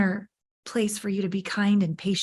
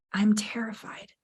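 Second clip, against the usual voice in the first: whispered, voiceless admission; whispered confession; 6 dB quieter, slower than usual. Retold or imitated. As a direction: let the fear slip out in a whisper. Confessing fear to the men.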